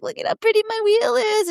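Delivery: put-on high, delighted little girl voice; imitating a child admiring a wheelchair. Daughter Ella admiring the wheelchair, high childlike delight. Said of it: "she'll just be like".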